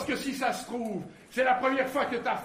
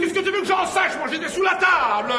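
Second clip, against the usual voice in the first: loud, raised-pitch comeback; heated, raised-voice self-defence; exasperated retort; 4 semitones higher, 7 dB louder than usual. Acted. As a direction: snap back in exasperation. Heated retort while hiding.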